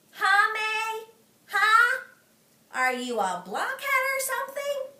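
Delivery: high, loud sing-song jeering; sing-song taunt. Acted. Junie jeering at May in a high sing-song. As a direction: poke at her with each huh.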